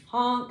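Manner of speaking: loud honking goose. Pretend. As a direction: honk it out like a goose. Goose says honk in book.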